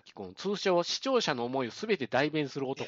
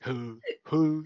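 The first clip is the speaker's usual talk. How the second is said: mock Osaka-style musing sound; odd Osaka intonation; 3 dB louder than usual. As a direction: mimic a drama man's exaggerated "hoo". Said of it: "今時あんなイントネーションで喋る人おる？".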